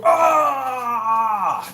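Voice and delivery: mock angry shout into a pillow; frustrated scream. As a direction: let out a hammed-up rage yell. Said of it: "you can bite down and just go".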